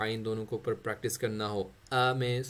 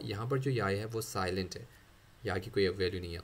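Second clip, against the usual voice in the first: ordinary talk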